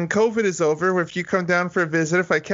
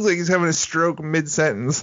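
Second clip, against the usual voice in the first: ordinary talk, nothing marked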